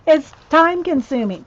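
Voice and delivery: loud, high exasperated groan. Real, not acted. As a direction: burst out, fed up. Exasperated about slow work.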